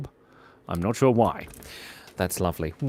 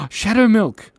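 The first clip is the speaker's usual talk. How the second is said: gasp, then exclaim the name high; delighted shock at the name; high excited gasp; surprised gasp; 9 semitones higher, 5 dB louder than usual. Real, not acted. Gasps at a viewer's name.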